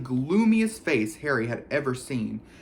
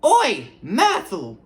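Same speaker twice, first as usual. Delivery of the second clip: much higher, loud yell across the room; bellowing; 7 semitones higher, 8 dB louder, much slower than usual. A narrator's reading. Peeves calling Myrtle over, a loud bellow. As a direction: bellow it out to call her.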